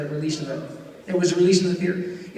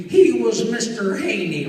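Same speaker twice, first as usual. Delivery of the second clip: slow, lightly cracking country voice; drawn-out countrified impression; Pat Buttram; 2 semitones higher, 4 dB louder, slower than usual. Shown as a demonstration. Comic reminds fans of Green Acres, cracking country voice. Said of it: "he spoke like that in real life".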